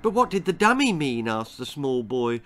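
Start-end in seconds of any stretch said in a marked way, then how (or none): 0.0-1.3 put-on high, quick boyish question; puzzled boy Jack. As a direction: press for an answer like a child.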